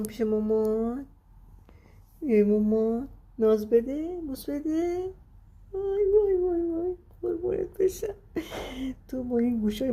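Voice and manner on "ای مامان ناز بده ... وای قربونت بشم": cooing pet talk. Real, not acted. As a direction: coax a frightened little one gently. Coos to pet again.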